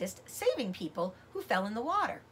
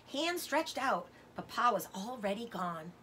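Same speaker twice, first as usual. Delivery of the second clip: plain reading voice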